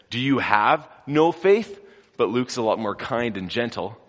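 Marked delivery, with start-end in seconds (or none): 0.1-1.8 aggressive. Acted. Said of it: "he gets even more aggressive".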